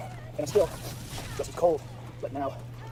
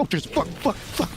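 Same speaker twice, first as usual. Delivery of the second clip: pained swearing; 8 semitones higher, slower than usual. Retold or imitated. Own first cold plunge.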